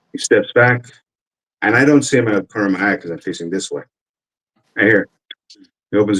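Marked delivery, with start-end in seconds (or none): none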